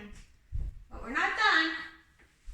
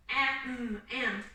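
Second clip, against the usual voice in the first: am, am slowly dragged out, each am falling lower; hesitant sounding out; 3 semitones lower than usual. Shown as a demonstration. Teacher showing a child the slow way not to read. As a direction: sound out am, am like a struggling reader. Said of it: "You don't wanna have to go".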